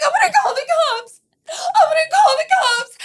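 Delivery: high, wailing mock sob; hammed-up crying girlfriend. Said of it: "she's crying now".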